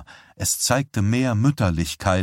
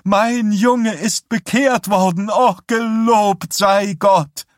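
very high, loud, slow ecstatic praising; mother praising God over her son's conversion; jubilant praise; 14 semitones higher, 6 dB louder, slower than usual. A narrator's reading. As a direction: exult to God at the top of the voice. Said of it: "rief Vera jauchzend aus".

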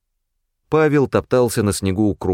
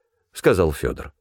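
ordinary delivery

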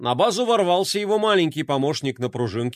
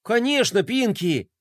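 eager agreement; 7 semitones higher than usual. Acted. Smitten Fly eagerly agrees.

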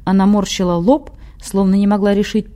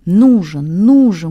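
punched, louder urging; 5 dB louder, much slower than usual. A narrator's reading. Watcher silently eggs on the one remembering, urging. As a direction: egg them on with some force. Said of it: "мысленно подбодрил его".

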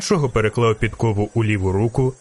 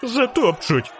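shrieking; 11 semitones higher, slower than usual. A narrator's reading. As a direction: squeal as if about to be trampled. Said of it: "верещав жовтець". (переляканий вереск високим голосом)

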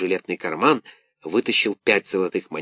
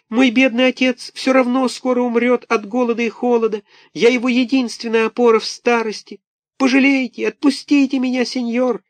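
put-on very high, wailing small-boy voice; pitiful little puppet; 4 dB louder than usual. A narrator's reading. Buratino pleads with Karabas, high wailing. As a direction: wail like a poor orphan puppet.

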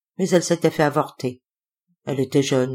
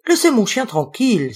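higher, indignant protest; indignant exclamation; 6 semitones higher, 4 dB louder than usual. A narrator's reading. Denise defends her dog from the man's teasing, exclaiming indignantly. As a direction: exclaim it in mild outrage. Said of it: "s'exclama Denise".

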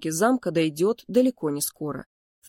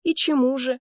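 higher, indignant query; challenging question; 7 semitones higher than usual. A narrator's reading. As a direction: demand an answer from a brother. Indignant brother demands to know. (с вызовом, возмущённо)

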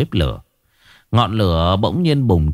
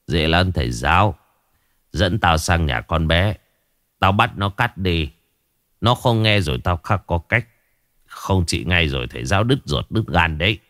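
put-on lower, unhurried old shaman talking to the teacher; old shaman's voice; 3 semitones lower than usual. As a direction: speak down like a village shaman sure of his power.

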